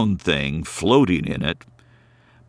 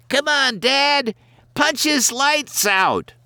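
put-on much higher, egging on a bratty boy; a spoiled kid urging his dad to fight. A narrator's reading. Ronnie goading his dad to hit Santa, high and bratty.

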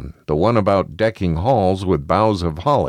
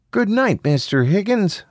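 put-on high-pitched little kid; high child voice, politely; 13 semitones higher than usual. A narrator's reading. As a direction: speak like a well-mannered young child.

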